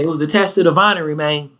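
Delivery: fast, "vinery" for winery; quick imitation of the friend. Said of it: "He said it just like that".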